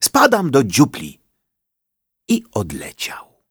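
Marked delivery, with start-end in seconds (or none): 0.0-1.2 high, hurried bird voice; a jittery bird blurting it out; lightly voiced nervous woodpecker. A narrator's reading. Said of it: "nerwowo dziobnął sosnową igłę".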